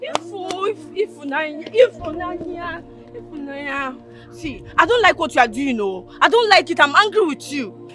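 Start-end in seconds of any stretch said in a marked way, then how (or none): 4.8-6.8 firm, louder scold. Acted. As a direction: tell her off. Scolding a wandering girl.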